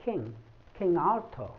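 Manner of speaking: किंग with an airy kh at the start, both times; aspirated k. Shown as a demonstration. Teacher showing the kh sound in King Arthur, aspirated. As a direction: puff the k of किंग. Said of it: "ख sound निकालता है वो".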